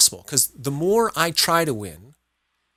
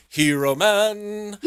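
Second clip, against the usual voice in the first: mock superhero; 6 semitones higher, much slower than usual. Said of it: "And I'll go".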